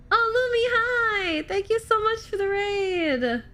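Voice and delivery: high-pitched squeal of welcome; excited raid greeting. Real, not acted. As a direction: greet the raiders high and drawn out. Greeting a friend's incoming raid.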